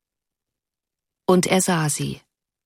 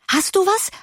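eager cry; 14 semitones higher than usual. A narrator's reading. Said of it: "schrien sie erwartungsvoll".

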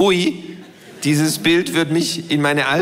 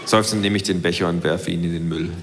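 ordinary delivery

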